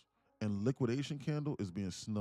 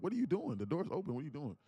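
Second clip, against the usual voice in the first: higher mock-baffled question; teasing disbelief; 7 semitones higher than usual. He teases his girlfriend at the open door, higher mock disbelief. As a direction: act baffled to tease her.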